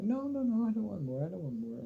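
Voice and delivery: mock refusal. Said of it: "I'm like".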